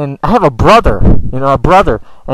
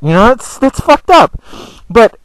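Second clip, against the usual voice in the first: ordinary talk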